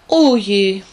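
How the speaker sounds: loud, high mock lament; wailing oh dear. Ladybug learns he must fly on, loud high wail. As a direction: wail it out like a dismayed little beetle. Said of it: "jammert Olli".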